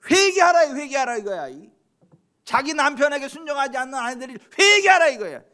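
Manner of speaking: high, loud cry for repentance; full-force preaching. Acted. Preacher commands wives and husbands to repent, full-force preaching. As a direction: thunder it out from the pulpit.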